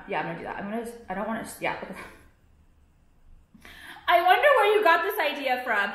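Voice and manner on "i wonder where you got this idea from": loud, high-pitched mock wondering; sarcastic call-out. Sarcastic jab at the copier.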